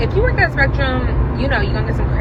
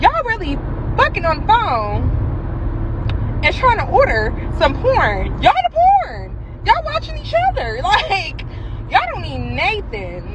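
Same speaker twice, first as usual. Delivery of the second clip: way higher, squealing disbelief; incredulous squeal; 6 semitones higher than usual. Real, not acted. Mocking the swinger couple ordering porn, high squeal. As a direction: shriek it like you can't believe them.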